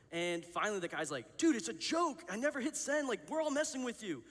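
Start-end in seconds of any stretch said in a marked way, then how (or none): 1.4-4.2 much higher, louder mock pleading; exasperated prank reveal. Friend telling the upset victim it's a joke, high-pitched urgent reveal. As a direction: imitate a guy urgently calling off the joke.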